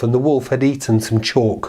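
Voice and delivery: usual speaking voice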